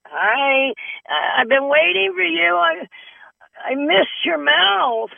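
very high, squeaky teeth voice; sweet little falsetto for the lost dentures; high cartoon voice of the false teeth; talking dentures. Talking dentures greeting her.

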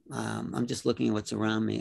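normal talking voice

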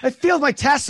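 high, loud imitation of a child; upset kid. Kids telling dad they failed, high and loud. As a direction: pitch up as a kid reporting disaster.

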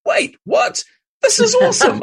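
excited shriek, jumping way up high and loud; freaking out. Retold or imitated. Hearing his friend is a published author, excited squeal. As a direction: squeal it with pure delight at the news. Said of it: "I was freaking out".

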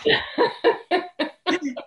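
high burst of disbelieving laughter; real high laugh. Real, not acted. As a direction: laugh at the absurdity. Laughing at Oprah coincidence.

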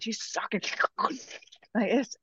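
hushed, breathy whisper. Retold or imitated. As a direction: drop to a whisper while describing. Describing the healer sucking.

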